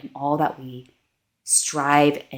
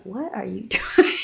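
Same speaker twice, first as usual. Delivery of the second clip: higher, laughing mock question; amused retold God; 6 semitones higher than usual. God interrupts her self-talk, voiced with amused disbelief. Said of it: "I hear God talk in".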